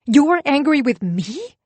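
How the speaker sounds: slow, high, loud disbelieving yell; incredulous yell. Acted. Wife yells at husband in outraged disbelief. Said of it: "I yell, incredulous".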